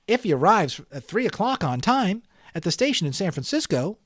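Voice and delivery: put-on an eager boy working it out; young boy. A narrator's reading. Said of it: "the young boy reasoned".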